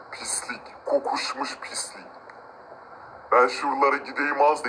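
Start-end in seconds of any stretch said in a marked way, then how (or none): 0.1-2.1 a sneering whisper, barely voiced; contemptuous whisper. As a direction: hiss it under your breath in disgust. Sneering at the poor man. (fısıltıyla tiksinerek)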